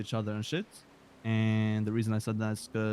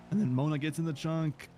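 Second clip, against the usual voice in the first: voice crack; 5 semitones higher than usual. Real, not acted. Telling the boss the story when the voice cracks. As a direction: voice cracking up high. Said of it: "Voice crack".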